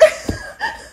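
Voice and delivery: high breathy laugh through tears; teary laugh. Real, not acted. Choking up over the film, a teary laugh. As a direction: laugh to hold back tears. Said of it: "You're gonna make me cry".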